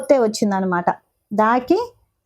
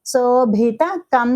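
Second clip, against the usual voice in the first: Sobhita said slowly with an aspirated భి; slow, careful syllables; much slower than usual. Shown as a demonstration. Teacher showing the aspirated భి, slow. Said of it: "భి అన్నప్పుడు ఇక్కడ మహాప్రాణాక్షరం".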